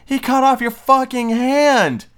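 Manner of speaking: high, loud mocking rant; outraged rant. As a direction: rant at the screen in disbelief. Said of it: "and I'm like".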